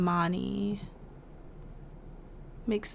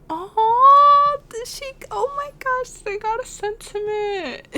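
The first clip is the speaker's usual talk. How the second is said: much higher, louder delighted squeal; oh my gosh squeal; squealing surprise; 12 dB louder than usual. Real, not acted. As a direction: gush in shrill disbelief over the moment. Reacting to sim crying scene.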